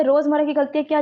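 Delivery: ordinary talk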